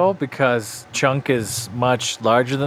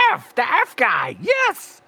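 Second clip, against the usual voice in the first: high, excited blurt of the name; barely held excitement; 8 semitones higher, slower than usual. Acted. Tiny hero to the girl, straining to stay calm and excited. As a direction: try not to sound excited but fail. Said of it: "trying to not be excited".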